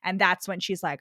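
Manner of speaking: ordinary delivery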